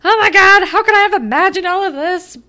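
high, loud, fast meltdown; mock hysterical wail; [name] flipping out. [name] panicking at Sav, high and loud. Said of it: "[name]'s flipping out".

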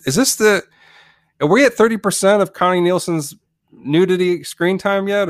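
loud, high-pitched heckling query; calling out. Acted. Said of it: "and I'd be like".

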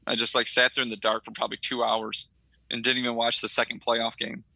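usual speaking voice

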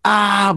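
a short high yelp of fright; mock startled yell. The ghost boy startled by him, mock yelp. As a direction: imitate the boy's silent startled cry.

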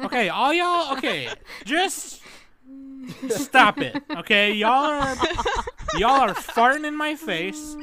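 high, loud hammed-up scolding; mock rant. Mock rant at cohosts for trolling.

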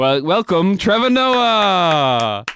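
loud, high, hugely drawn-out guest intro; announcer introduction. Pretend. Host welcomes an imaginary guest on, announcer introduction. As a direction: belt out the name, stretching it long.

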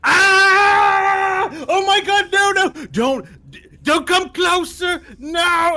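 high panicked shrieks of a man begging; shrieking in panic as the fear spell hits; terrified screaming. Acted. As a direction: scream in terror, pleading. GM voices terrified NPCs screaming.

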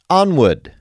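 high put-on British onward; hammed-up English sign-off; mock British accent. Comedian moving to next caller in fake British. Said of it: "I've been practicing my, uh, English".

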